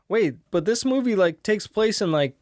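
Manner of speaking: wait, that can't be right; surprised realization. Retold or imitated. Realizing movie's 1983 date.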